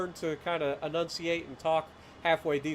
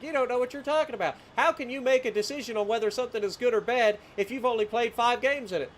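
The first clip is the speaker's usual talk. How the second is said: higher-pitched mock challenge; mimicked critic; 6 semitones higher than usual. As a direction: imitate a doubting viewer's complaint. Mimics critics doubting his judgement.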